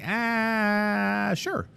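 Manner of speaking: high, delighted "Ah, sure"; pleased surprise. Retold or imitated. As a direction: jump at the offer of fish. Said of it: "And I was like".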